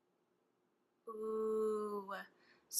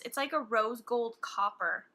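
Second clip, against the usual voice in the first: ordinary talk, nothing marked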